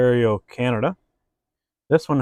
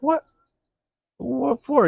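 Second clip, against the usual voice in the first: high, incredulous what for; indignant surprise; 13 semitones higher, 3 dB quieter, slower than usual. Acted. Driver protesting his handcuffing, high incredulous surprise. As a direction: jump up in pitch, genuinely baffled.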